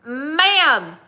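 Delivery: high, loud, slow southern drawl; southern kid accent. Retold or imitated. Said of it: "why he has a southern accent".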